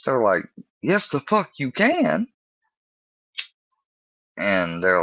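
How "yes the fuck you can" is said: high, drawn-out friend imitation; slow falsetto comeback; mock insistence. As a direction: imitate a pal insisting, pitched way up. Friends arguing back at him.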